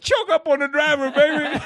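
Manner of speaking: high, loud shout back; shouted reply. Retold or imitated. Caddy shouting advice back up to the tee, loud shout.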